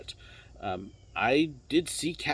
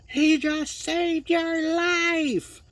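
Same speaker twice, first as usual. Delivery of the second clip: much higher, slow, drawn-out imitated shout; driver's yell; 3 dB louder, much slower than usual. Trolley driver yelling at the guest, imitated slow shout. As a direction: stretch every word like a trolley driver calling out. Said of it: "the person driving the trolley yelled at them".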